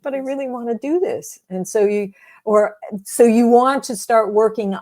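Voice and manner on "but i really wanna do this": hopeful inner voice. Retold or imitated. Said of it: "the other one's voice is saying".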